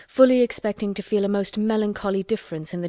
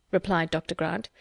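ordinary delivery

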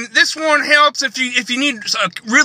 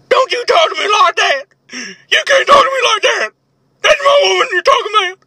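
higher, rising protest that builds; mock indignant outrage; 9 semitones higher than usual. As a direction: defend your woman, hammed up. Acted quarrel over Brenda.

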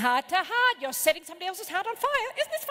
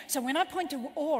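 ordinary delivery

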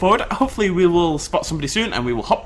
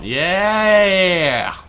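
a real burst of joy, drawn out; excited yell; 3 semitones higher, 4 dB louder than usual. Real, not acted. Eating a tree in the game, an excited yell. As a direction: cheer as the tree falls. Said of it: "I get very excited".

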